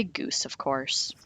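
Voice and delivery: usual speaking voice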